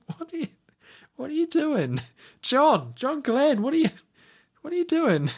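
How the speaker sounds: high, pleading disbelief; exasperated disbelief. Real, not acted. Complaining to the director John Glen about the ending, a high exasperated plea. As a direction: plead with him, pitch soaring in exasperation.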